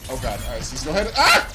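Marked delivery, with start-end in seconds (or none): 1.1-1.5 short high loud yelp; sharp yell. Real, not acted. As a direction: let out a sudden yelp. Yells before handing over turn.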